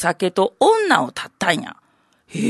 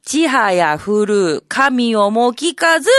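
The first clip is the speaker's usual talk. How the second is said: poem recital; slower than usual. Shown as a demonstration.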